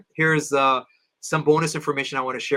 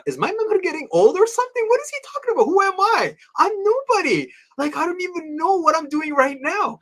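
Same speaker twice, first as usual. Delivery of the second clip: high-pitched, bewildered self-talk; incredulous; 13 semitones higher, 3 dB louder than usual. Retold or imitated. Young student privately doubting his teacher's predictions, incredulous. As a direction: protest in disbelief, voice jumping way up.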